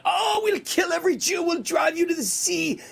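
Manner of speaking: high, shouted parody of threats; mocking shouted threats. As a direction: shout them out as a mocking caricature. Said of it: "You know".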